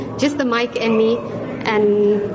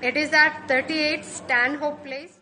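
put-on higher, slow, thick Indian accent; 5 semitones higher, 5 dB quieter than usual. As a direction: play Anna Lakshmi with a thick Indian accent. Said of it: "in character using a thick accent".

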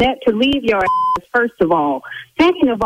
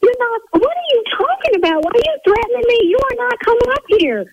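high-pitched, indignant outburst; worked-up yelling; 12 semitones higher, faster than usual. Real, not acted. Salon owner challenges caller threatening to come up, yelling indignantly. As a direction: voice shoots way up in outraged disbelief. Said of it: "See, now I'm yelling".

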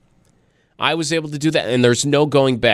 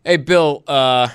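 normal talking voice

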